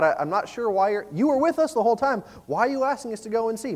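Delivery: stammer, then argue back in disbelief; acted bewilderment, pitch climbing; baffled protest. Disciples baffled by Jesus's request.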